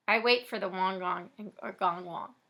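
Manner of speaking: ordinary delivery, nothing marked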